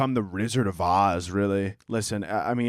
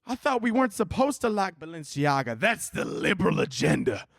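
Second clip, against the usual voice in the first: hammed-up indignant congregant, pitched high at first; mock-preachy outrage, stretched-out words; outraged churchgoer; 3 semitones higher than usual. Congregant calling out the pastor over his Balenciaga jacket, outraged. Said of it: "someone in the congregation was like".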